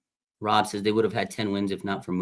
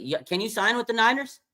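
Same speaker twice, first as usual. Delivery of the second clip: high, doting mom voice, pleading sweetly; a sugary mother nagging her son, pitched way up; Cheryl, Joey's mom; 11 semitones higher than usual. Retold or imitated. Mom calling son Joey to sign, high doting plea. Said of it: "And then she".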